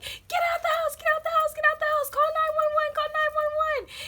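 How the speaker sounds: shrieking high, loud re-enacted alarm; all-out yelling. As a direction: reenact yelling over a brother's headphones. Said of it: "I'm yelling as loud as I can".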